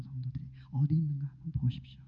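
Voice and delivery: a hushed, pressing challenge; rapid whisper. Real, not acted. Preacher challenging the congregation in a rapid whisper. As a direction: whisper it quickly and pointedly.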